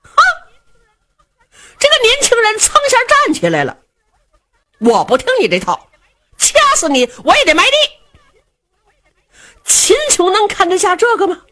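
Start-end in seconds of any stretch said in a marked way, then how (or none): 4.8-7.7 defiant son. Acted. Son shouting back.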